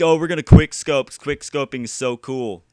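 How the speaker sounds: mock the quick-scope fans; hammed-up fan impression; mock gamer voice.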